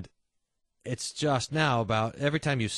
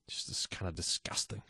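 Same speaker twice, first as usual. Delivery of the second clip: whispered disgust; 8 semitones lower, 10 dB quieter than usual. Real, not acted. Disgust at dirty robe.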